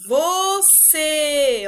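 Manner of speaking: loud, drawn-out VOCÊ, stretched like calling someone; sustained calling of VOCÊ, strong on CÊ; word called out. Shown as a demonstration. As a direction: call out "você", holding the syllables long. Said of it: "Se vocês chamarem a palavra".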